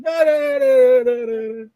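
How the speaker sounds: high, loud string of nonsense syllables; hammed-up carefree babble; exuberant mock chatter. Mimes breezy chatter after greeting.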